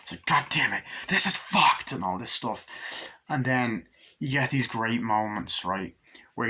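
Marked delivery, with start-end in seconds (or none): 0.3-1.9 higher, mock exasperated cry; hammed-up movie outburst; retold angry outburst. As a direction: act out cursing in frustration. Acted outburst of film character.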